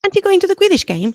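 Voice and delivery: high, quick friendly question; cheery boy voice. A narrator's reading. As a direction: ask brightly and fast.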